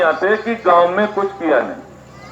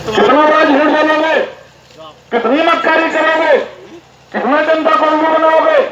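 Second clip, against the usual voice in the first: indignant accusation; 6 semitones higher than usual. Real, not acted. Rally speaker accusing government.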